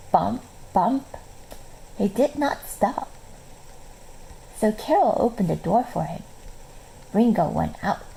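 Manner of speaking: usual speaking voice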